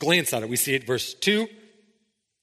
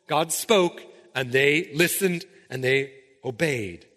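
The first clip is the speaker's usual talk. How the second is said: ordinary talk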